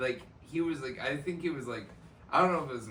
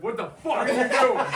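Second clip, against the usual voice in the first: indignant yell; 8 semitones higher, 5 dB louder than usual. Retold or imitated. Confronting guy peeking over stall.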